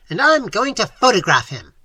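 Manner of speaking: triumphant bawl. A narrator's reading. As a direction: shout it in triumph. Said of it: "bawled Professor Small triumphantly".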